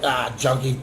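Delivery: low, soft, contemptuous aside; quiet scornful sneer; dismissive. Retold or imitated. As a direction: brush him off as a nobody. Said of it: "they look down like".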